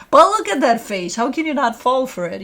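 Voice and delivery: gushing over dog. Real, not acted. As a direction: gush over an irresistible pet.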